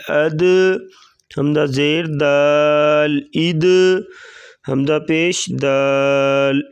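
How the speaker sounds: slow, measured spelling-out of each letter; qaida drill recitation. Shown as a demonstration. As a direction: chant the syllables as a teacher drilling children.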